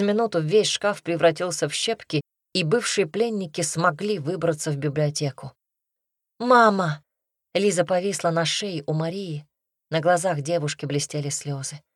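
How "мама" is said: higher, louder call to mother; emotional exclamation, moderately raised; joyful cry. A narrator's reading. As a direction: cry out as a daughter reunited with her mother. Daughter cries out on reunion.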